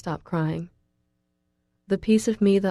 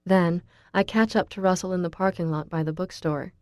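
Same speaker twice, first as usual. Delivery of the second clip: usual speaking voice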